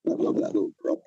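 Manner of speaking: barely voiced, breaking with emotion; choked up. Real, not acted. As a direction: hold back tears on the words. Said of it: "sorry, it's emotional".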